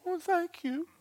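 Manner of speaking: tiny falsetto thanks; very high, small grateful voice; meek self-imitation. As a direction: squeak it softly, mock-pitiful. Retelling his meek reply.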